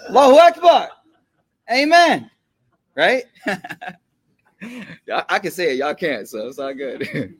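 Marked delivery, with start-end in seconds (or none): 0.1-2.3 higher and louder Allahu Akbar, ringing out; full-voiced, higher call of Allahu Akbar; fervent church shout. Shown as a demonstration. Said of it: "Nah, yo".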